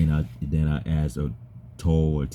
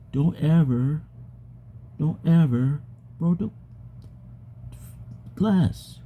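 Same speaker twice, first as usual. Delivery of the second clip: high voice; 11 semitones higher than usual. Real, not acted. High voice while retelling bus story.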